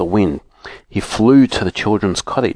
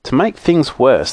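usual speaking voice

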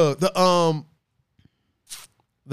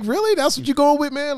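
incredulous; 9 semitones higher than usual. Retold or imitated. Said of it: "I'm like".

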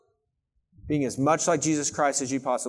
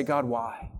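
quiet plea; 4 semitones lower, 8 dB quieter than usual. Retold or imitated. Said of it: "you can go to God and say".

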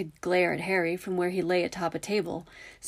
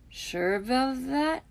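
slow, higher, drawn-out doubting quip; quipping; 7 semitones higher, much slower than usual. A narrator's reading. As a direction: draw out the doubt as a joke. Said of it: "Ron quipped".

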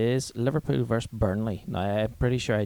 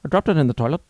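loud, higher mock Indian accent; angry Indian accent; 5 semitones higher, 9 dB louder, faster than usual. Caller answering the supervisor, loud mock Indian accent. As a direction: own up in a hammed-up Indian accent. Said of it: "Angry Indian wanting to know".

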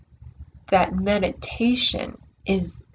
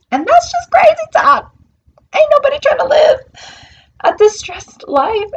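a much higher, louder sassy exclaim; mock outrage; 11 dB louder than usual. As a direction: throw it off as crazy talk, loud and high. Dismissing drama-seeking.

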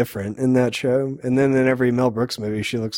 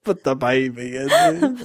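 put-on slow, higher Austrian accent; Schwarzenegger impression; 8 semitones higher than usual. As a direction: stretch out the Arnold-style line. Repeating Arnold line.

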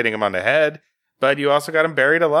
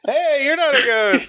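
very high, hammed-up greeting; squeaky-high fake delight; mock excitement; 14 semitones higher than usual. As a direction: parody an overexcited character, voice pitched way up. This book is just excited.

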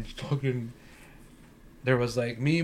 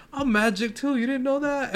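higher-pitched quoted grandpa; voiced grandfather; 11 semitones higher than usual. Grandfather brags to the kids about magic, voiced higher. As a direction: play the boasting grandfather, pitched up. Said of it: "my grandfather was like".

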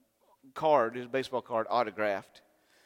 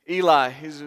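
usual speaking voice

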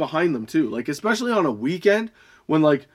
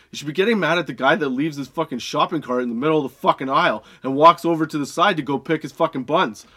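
ordinary talk

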